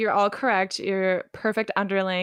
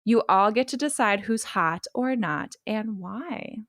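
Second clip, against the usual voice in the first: ordinary talk, nothing marked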